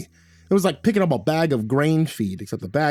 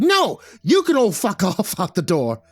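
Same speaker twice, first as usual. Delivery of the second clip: high, loud furious bellow; irate leprechaun roar; 8 semitones higher, 3 dB louder than usual. A narrator's reading. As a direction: bellow a furious refusal, pitch way up. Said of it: "He balled up his hairy little fists and bellowed".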